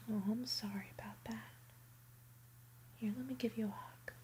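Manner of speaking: ordinary talk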